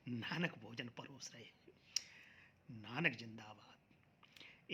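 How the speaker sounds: low, soft, fading into a whisper; hushed refrain. Real, not acted. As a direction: echo it quietly, slowing to a near-whisper. Echoes his line in hushed reverence.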